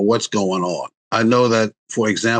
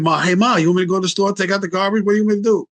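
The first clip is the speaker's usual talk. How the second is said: bright eager calling out, higher; eager offer; 8 semitones higher, faster than usual. Retold or imitated. Adult daughter greeting her sick mom, eager and much higher. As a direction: call out keen to help.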